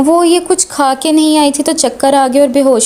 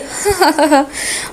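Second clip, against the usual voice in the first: short quiet giggle; soft girls' giggle; 2 semitones higher, 4 dB quieter than usual. A narrator's reading. Friends laughing at Hoor's joke, soft giggle. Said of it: "Misbah अनबरीन हंसने लगी थी".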